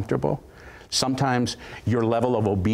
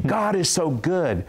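higher and a bit slower; higher, insistent declaration; 5 semitones higher, slower than usual. Retold or imitated. Woman repeating her praise to the pastor, pitched higher. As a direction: lift it up with conviction. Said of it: "she began to declare these words".

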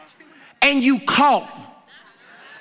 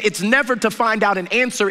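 normal talking voice

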